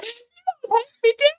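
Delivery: high, wounded little plea; mock hurt dad. Poor dad seeing this show, high pleading mock hurt.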